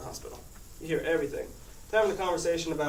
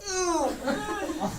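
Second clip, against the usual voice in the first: laughing sneeze groan. Retold or imitated.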